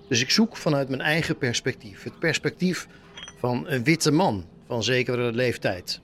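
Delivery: ordinary delivery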